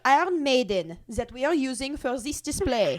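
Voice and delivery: high, loud mock French accent; hammed-up French-accented presentation. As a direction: play a French guide showing off a horror. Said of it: "do it with a French accent".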